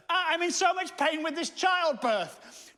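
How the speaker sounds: high, drawn-out hammed-up wail; mock labour pain. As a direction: act out agony with exaggerated slow moaning. Mock wife complaining of labour pain.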